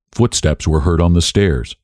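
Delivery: plain reading voice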